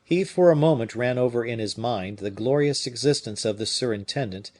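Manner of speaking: usual speaking voice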